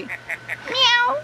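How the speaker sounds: series of very high mewing calls; high-pitched mock cat; kitty cat meow. Shown as a demonstration. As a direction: imitate a tiny kitty, one meow at a time. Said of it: "What does the kitty cat say?".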